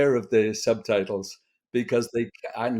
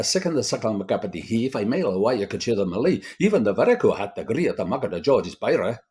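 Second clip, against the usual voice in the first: reciting Burns in broad Scots, faster; Scottish accent; faster than usual. Shown as a demonstration. Showing students an incomprehensible Scottish accent, Burns recital. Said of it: "This is from a Robbie Burns poem".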